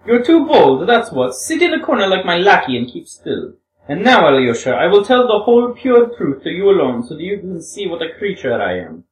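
a hint of a young woman, earnest and pleading; lightly voiced Grushenka. Grushenka voice again.

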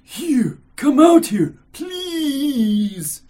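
high, loud, insistent summons; firm and insistent. Acted. Stranger summons Mitya from behind curtain, firm and insistent. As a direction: call out firmly, stretching the plea. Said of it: "but firmly and inc-, and insistently".

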